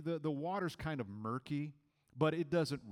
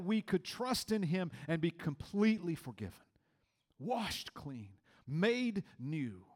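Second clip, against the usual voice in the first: preaching; 2 semitones higher, 3 dB louder than usual. Acted. Pastor preaching to the congregation about grace, emphatic. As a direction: drive home the rescue message to the congregation.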